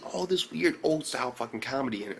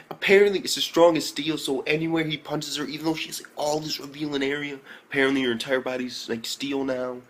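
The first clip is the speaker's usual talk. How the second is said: ordinary talk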